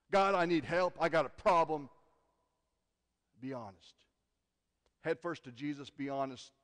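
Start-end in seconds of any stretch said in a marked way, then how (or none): 0.1-1.9 loud plea. Pretend. Acted plea to God.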